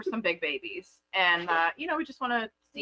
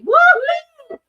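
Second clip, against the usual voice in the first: excited cheer; 13 dB louder than usual. Real, not acted. Celebrating invoice arriving.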